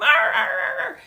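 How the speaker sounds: very high hammed-up sob noises; fake-cry like a cartoon baby; mock wailing sobs over a medium skirt; mock sobbing wail. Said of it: "I'm having a meltdown".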